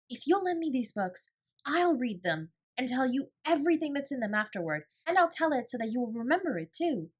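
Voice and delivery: high and bright with excitement; eager rush. A narrator's reading. Sarah offering to retell the books, eager. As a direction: pour out the plan eagerly. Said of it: "she cried".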